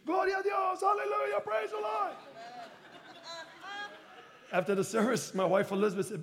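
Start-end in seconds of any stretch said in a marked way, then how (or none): 0.1-2.1 congregation cheering in praise. Retold or imitated. Church cheering the pastor's fundraising run, high celebratory cry. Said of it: "and the church".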